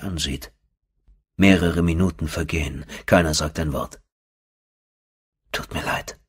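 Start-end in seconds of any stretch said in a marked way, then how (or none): 5.5-6.2 a barely voiced, tender whisper of apology; soft whisper. A narrator's reading. Sierra whispering her apology to him after the silence. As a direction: breathe it, almost no voice. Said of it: "flüstert sie irgendwann leise".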